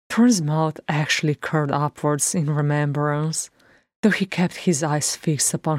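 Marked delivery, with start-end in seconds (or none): none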